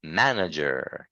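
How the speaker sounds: "Manager" with weight on the first syllable. Shown as a demonstration. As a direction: put MAN on top, let the rest of "Manager" drop. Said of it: "higher on the first sound".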